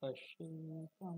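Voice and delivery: পশ্যেতাম stretched slowly, final m held; Sanskrit pronunciation. Shown as a demonstration. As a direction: teach পশ্যেতাম with long Sanskrit vowels. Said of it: "এটা হচ্ছে সংস্কৃত উচ্চারণ". (পশ্যেতাম ধীরে টেনে সংস্কৃত উচ্চারণে)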